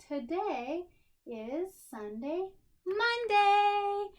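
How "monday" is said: high, loud and bright-eyed reveal of the day; excited announcement. Real, not acted. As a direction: sing out the day like big news. Teacher announcing today's day.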